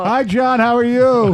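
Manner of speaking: hammed-up high, loud fake caller; lightly mask the voice like a prank caller; disguised caller voice. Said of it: "you gotta mask your voice a little more than that".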